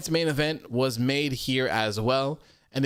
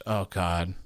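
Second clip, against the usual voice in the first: ordinary talk, nothing marked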